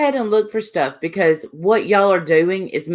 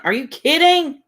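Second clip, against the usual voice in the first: much higher, scoffing disbelief; incredulous scoff; 10 semitones higher than usual. Real, not acted. Scoffing at the commenter who mocks accents, incredulous. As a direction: throw it back as if it's absurd.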